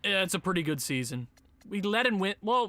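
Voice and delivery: ordinary talk, nothing marked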